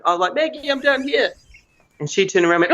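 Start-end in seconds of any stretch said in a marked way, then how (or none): 0.3-1.3 high, restrained call; retold calling out. Stuck man to Maggie walking past, mock calling out. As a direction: imitate calling to someone without real volume.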